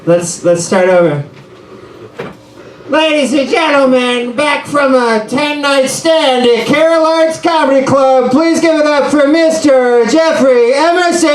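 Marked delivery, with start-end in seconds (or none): 2.9-11.3 booming comedy club introduction; emcee intro. Pretend. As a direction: hype the act like a club host. Announces himself as emcee.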